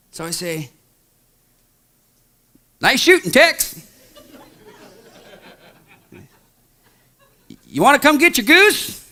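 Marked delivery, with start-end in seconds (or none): 2.8-9.0 calling out. Retold or imitated. Calling out to hidden shooter.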